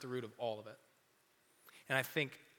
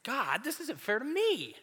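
high, whining "God, this isn't fair to me"; high petulant complaint; whiny complaint; 10 semitones higher than usual. Retold or imitated. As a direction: whine to God like a sulky kid.

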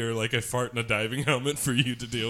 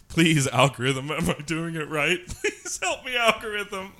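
louder, higher hammed-up begging; mock pleading; 7 semitones higher, 4 dB louder than usual. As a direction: plead with the algorithm in jest. Begging the algorithm jokingly.

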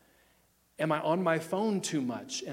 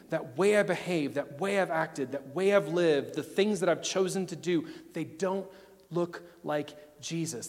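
normal talking voice

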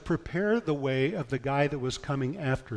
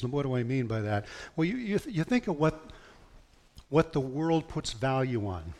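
normal talking voice

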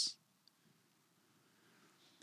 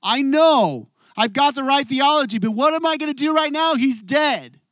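raised, higher, pleading cry of pain; anguished protest; 7 semitones higher, 5 dB louder than usual. Retold or imitated. Martha crying out to Jesus over her dead brother, anguished and raised. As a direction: push it out high and hurt, like a grieving sister. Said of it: "but I'm hurting right now".

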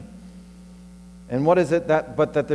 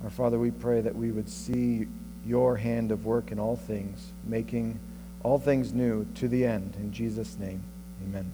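prayer; 5 dB quieter than usual. Acted. Closing prayer.

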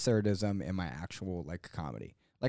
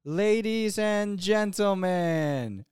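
slow, raised, drawn-out ringmaster call; imitating child announcer; 7 semitones higher, much slower than usual. Toddler on a step stool addressing the room, mock grand announcement. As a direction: announce it grandly, stretching every word. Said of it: "I would just go".

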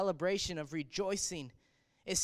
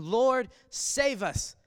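crying out; 4 semitones higher, 7 dB louder, much slower than usual. Retold or imitated. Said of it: "crying out to him, saying".

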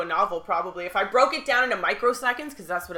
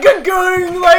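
high, very loud flustered blurt; starstruck squeal; 8 semitones higher, 12 dB louder, much slower than usual. Retold or imitated. Fan retells meeting her idol, starstruck squeal.

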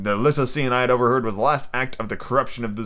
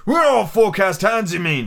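higher-pitched pirate snap; snapped retort; 8 semitones higher, 4 dB louder than usual. A narrator's reading. Silver corrects young Dick, snapping. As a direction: correct him as an irritated buccaneer. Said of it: "snapped Silver".

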